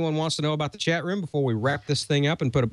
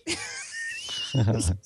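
laughter; 4 dB quieter than usual. Real, not acted. Laughing at own story.

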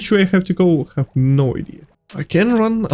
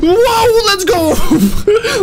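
startled shouting; 11 semitones higher, slower than usual. Real, not acted. Gamer spotting a monster, high shouted alarm. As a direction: shriek and bolt away. Startled then thrilled cry.

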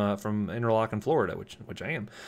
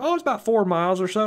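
high-pitched, louder country Florida twang; rural Florida accent; 11 semitones higher, 5 dB louder than usual. Retold or imitated. Caller guessing his distance from the car, rural Florida accent. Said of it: "talk like that all the time in my natural accent".

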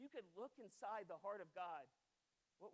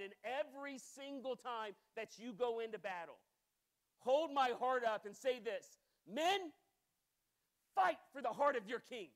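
rousing battle cry; 3 semitones higher, 8 dB louder than usual. Retold or imitated. King's battle cry quoted.

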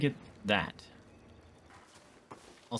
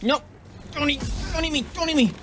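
panicked pleading. Real, not acted. Pleading with a spider.

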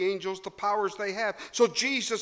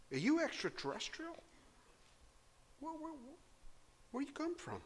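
soft stammering frightened governor; frightened Pilate; 3 semitones higher, 13 dB quieter than usual. Acted. Preacher voicing a frightened Pilate to Jesus, quiet stammer. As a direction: stutter it like a scared man. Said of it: "Very afraid".

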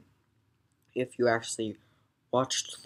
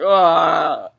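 pained yelp; 6 semitones higher, 16 dB louder than usual. Retold or imitated. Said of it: "And you'll go like".

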